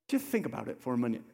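fast, at normal level; quick plain repeat. Shown as a demonstration. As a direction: toss it off, calmly. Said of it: "never say it with that intensity".

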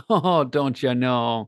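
put-on amused echo of a Minnesotan accent; long round Minnesotan o's. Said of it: "speak in this Minnesotan accent".

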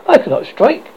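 high-pitched, mildly awed. A narrator's reading. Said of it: "he said with awe".

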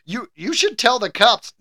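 usual speaking voice

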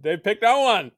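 high, loud exasperated answer; exasperated dad. Retold or imitated. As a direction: insist on it, at your wits' end. Acts exasperated dad answering son.

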